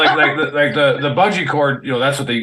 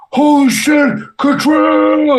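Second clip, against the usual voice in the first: loud, high-pitched holler of recognition; excited shout; 13 semitones higher, 5 dB louder, much slower than usual. Retold or imitated. Soldier spotting his old buddy on Bragg, shouting excitedly. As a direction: holler it out, stretching the name.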